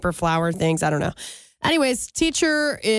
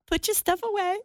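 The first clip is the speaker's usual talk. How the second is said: high, soft mocking singsong; mocking the teacher; 11 semitones higher, 4 dB quieter than usual. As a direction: parrot the teacher under your breath. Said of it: "I'm sitting up there like".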